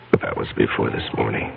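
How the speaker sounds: under the breath, to himself; muttered aside. Acted. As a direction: mumble it so nobody hears. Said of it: "Just mumbling".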